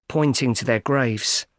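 plain reading voice

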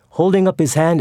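usual speaking voice

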